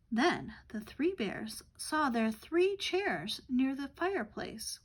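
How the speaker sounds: plain reading voice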